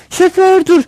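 high, loud call to stop; shouting passenger. A narrator's reading. Woman passenger yelling to the driver, high acted shout. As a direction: call out loudly to halt the driver. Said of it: "bağırır".

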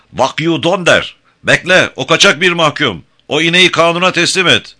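loud, high-pitched furious orders; shouted command, pushed up high; angry shouting. A narrator's reading. Colonel Kaiser ordering the fugitive handed over, shouting angrily. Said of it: "Kaiser'ın sesi öfkeyle boğuklaşmıştı".

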